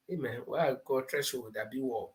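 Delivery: dropped to a murmur, off-mic; a quick hushed question to the person beside him; quiet aside. Real, not acted. Quiet aside asking a colleague.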